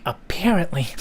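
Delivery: "apparently" with PAIR in the middle; goosebump-giving pronunciation. Shown as a demonstration. Trying the version that gives goosebumps, avoided pronunciation. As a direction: say "apparently" as the version that feels wrong. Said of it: "gives me, like, goosebumps when I say it".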